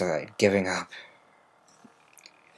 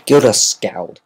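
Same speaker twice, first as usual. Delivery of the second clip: ordinary delivery, nothing marked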